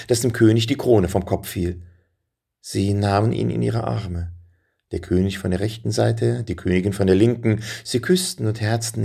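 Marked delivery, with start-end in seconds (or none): none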